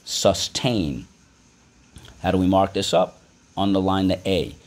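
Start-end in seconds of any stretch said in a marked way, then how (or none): none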